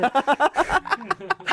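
high burst of laughter; real laugh. Real, not acted. Amused at his buddy tumbling down the ditch, laughing. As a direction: break into a real laugh mid-story.

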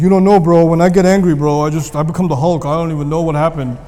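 bro excuse. Retold or imitated. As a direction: act the tough guy blaming his temper. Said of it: "And you're like".

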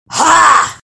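sudden loud high bark; troll yell. Acted. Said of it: "yelled the troll".

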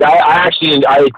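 ordinary talk

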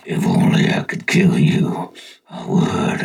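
very deep, slow, hissed demonic whisper; menacing whisper. Retold or imitated. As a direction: breathe the threat low and slow.

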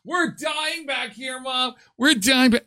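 high, drawn-out back-seat whine to mom; hammed-up child complaining from the back seat; whiny kid. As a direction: plead like a kid stuck in smoke.

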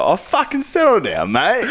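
high-pitched mock telling-off; mock indignant retort. Retelling a reply to a mate, mock indignant. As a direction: pitch jumps up in playful protest.

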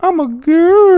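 put-on high, slow girly voice. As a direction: squeak it like a little girl.

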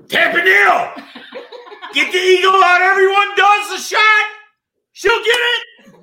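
loud, raspy hollering voice; alien voice yell. Pretend. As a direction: bellow it like a drill sergeant. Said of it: "You sound like Lieutenant Dan from Forrest Gump".

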